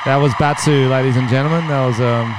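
announcer voice. Acted. Announcing the guest leaving.

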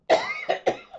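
sudden choking cough; coughing after something went down the wrong pipe. Real, not acted. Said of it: "something went down the wrong pipe, and I just started coughing really bad".

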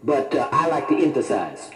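ordinary talk

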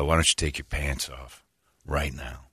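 low, soft retold threat; quiet menace. Gunman orders the kid to undress, quiet imitated menace.

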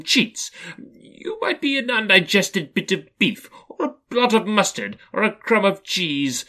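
high, loud, nervy wisecracking; Scrooge being smart. A narrator's reading. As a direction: joke to keep your terror down. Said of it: "he tried to be smart".

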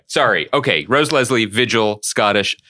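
ordinary talk

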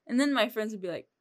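usual speaking voice

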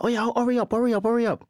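put-on high, loud Nigerian parent accent; impatient Nigerian mum, hurry up. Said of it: "in the Nigerian accent".

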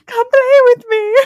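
very high, loud mock-horror voice; creepy playmate echo. Echoing the co-host's villain lure, hammed-up creepy call.

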